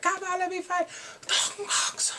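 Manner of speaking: high, strained mock plea; going nuts. Prisoner in his cell crazed for revenge, hammed-up frenzy. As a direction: imitate a frantic, breathy wail. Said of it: "He's going nuts for revenge".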